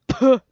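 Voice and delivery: "Puh" with a hard, aspirated P. Shown as a demonstration. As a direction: pop out a strong breathy "Puh". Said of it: "he said the P word with a hard P".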